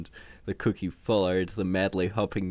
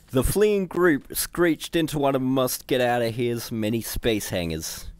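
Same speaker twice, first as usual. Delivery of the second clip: usual speaking voice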